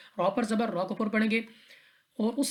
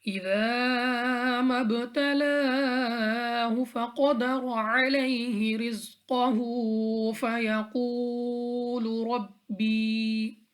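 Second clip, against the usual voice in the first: Quran recitation; 3 semitones higher, much slower than usual. Shown as a demonstration. Tajweed teacher recites the verse for students, slow melodic chant. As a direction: stretch every vowel in a long, sung recitation.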